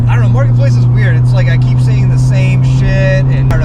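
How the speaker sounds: loud, energetic vlog talk. Real, not acted. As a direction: talk loud over the wind.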